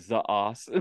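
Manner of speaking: "the ass" said as "za assu"; "the ass" with Japanese z for th; Japanese accent. Retold or imitated. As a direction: imitate Japanese pronunciation of "the ass". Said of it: "Sounds like".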